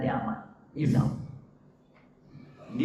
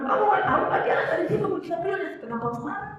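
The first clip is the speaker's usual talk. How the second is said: mock angry father; faster than usual. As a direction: play the irritated dad snapping at his child. Angry father voiced in a story.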